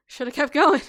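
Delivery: loud laughing quip. Real, not acted.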